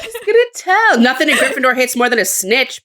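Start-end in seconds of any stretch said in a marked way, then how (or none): 0.0-1.0 laughing. Real, not acted. Laughing echo.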